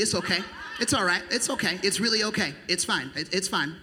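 usual speaking voice

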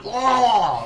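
loud, high cry of a man stabbed in the throat; high, hammed-up death yell; stabbed man's cry. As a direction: yell out as the blade goes in.

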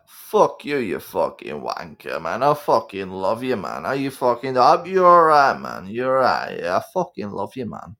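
slurred-affection Brummie swearing, trailing away softly; Caps. Acted. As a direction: swing from cursing to fond reassurance. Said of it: "Caps would be like this".

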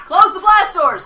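shouting. Retold or imitated. Quoting movie commander order.

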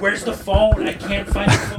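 high, strained mock yell; distant yelling on phone. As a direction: imitate frantic hollering away from the receiver. Imitates distant yelling on phone again.

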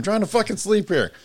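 high, loud fed-up complaint; mock exasperated yell. Retelling his reply to his partner at bedtime, mock exasperated yell. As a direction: blow up like someone kept awake.

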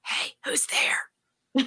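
slow, breathy hushed whisper; a slow, hushed whisper to an unseen intruder; mock whisper. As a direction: whisper it like you're scared to be heard. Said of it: "Like, whispering".

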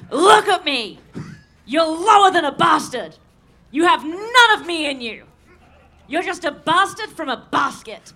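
loud, furious oil-baron tirade; angry Daniel Plainview. Acted. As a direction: act out a really angry, dark oil man, starting loud and easing slightly. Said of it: "angry oil man".

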